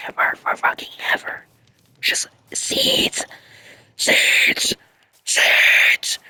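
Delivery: hushed exasperated whisper; late-night whispered gripe; whispered frustration. Real, not acted. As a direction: whisper it, fed up. Whispering, everybody asleep.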